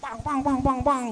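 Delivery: imitated pan banging. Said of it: "dikeras-kerasin tuh kuali itu".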